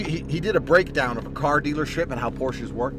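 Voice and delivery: ordinary talk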